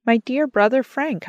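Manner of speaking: calling out in glad surprise; joyous cry. A narrator's reading. Woman greeting her dead brother-in-law, joyous cry. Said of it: "I cried out joyously".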